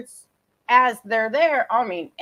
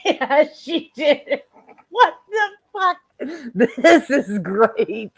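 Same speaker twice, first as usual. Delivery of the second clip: laughing; 3 semitones higher, slower than usual. Real, not acted. Host laughing hard.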